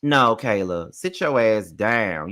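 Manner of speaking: ordinary talk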